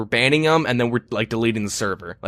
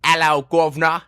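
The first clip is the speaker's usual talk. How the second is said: higher-pitched mock British accent; 5 semitones higher than usual. Shown as a demonstration. Urged by a friend to try it, mock British accent. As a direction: put on a hammed-up British accent. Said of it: "gonna try a British accent".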